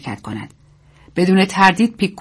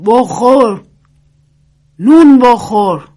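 high, loud, drawn-out urging; loud and clear; 5 semitones higher, 7 dB louder, much slower than usual. A narrator's reading. Old woman urging bread on lifeless soldier, loud and clear. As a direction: call it out slowly to someone barely conscious. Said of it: "با صدای بلند و رسا گفت".

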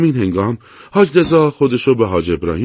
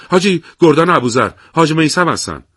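higher, louder urgent report; hurried; 6 semitones higher, 4 dB louder than usual. A narrator's reading. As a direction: hurry to hand over the radio. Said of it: "شتاب زده گفت".

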